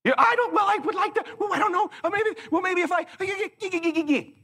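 high, dithering stammer; mock an indecisive stammerer. Mocking indecisive listener.

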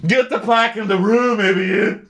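high, loud hammed-up scolding; mock angry order. Hostess ordering staff about, mock-furious. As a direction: imitate an exasperated hostess barking.

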